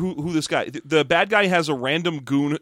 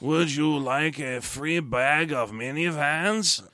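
hushed, slow mock French villain; bad French whisper; 5 dB quieter, much slower than usual. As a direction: murmur it slowly in a hammy French accent. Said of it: "a dude who whispers with a bad French accent".

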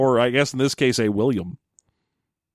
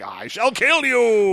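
slow, higher hammed-up threat; menacing villain; 8 semitones higher, slower than usual. Rahatan threatening Storm, slow hammed-up menace. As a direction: ham up a melodramatic death threat. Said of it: "And he's like".

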